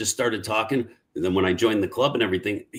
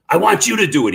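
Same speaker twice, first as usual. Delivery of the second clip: loud, higher demanding push; a retold Hulk flare-up, loud and high; mock mad insistence; 10 semitones higher, 8 dB louder than usual. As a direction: insist like he's getting mad. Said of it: "He got all mad".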